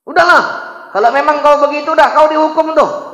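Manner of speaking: high, loud, fast verdict; king losing patience; furious sentence. Acted. King condemns Ibrahim, furious loud verdict.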